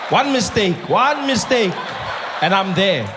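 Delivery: mock-tough, ready to fight; tough Cape colored. Gripping the butter knife, daring Malema in a mock Cape coloured accent. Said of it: "the colored side of me".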